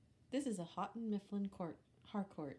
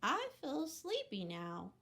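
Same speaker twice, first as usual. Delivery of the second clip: sleepy pig; 6 semitones higher than usual. Acted. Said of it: "Pig said".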